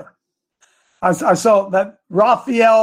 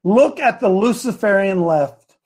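ordinary delivery

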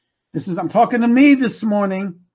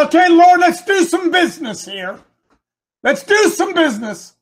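bold challenge; 10 semitones higher, 5 dB louder than usual. Acted. Acts prophet addressing God boldly.

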